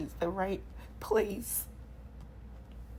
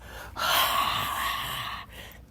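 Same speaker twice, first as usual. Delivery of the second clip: a long, airy breath out; heavy exhale. Shown as a demonstration. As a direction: push the breath out slowly. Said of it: "I just want you to just".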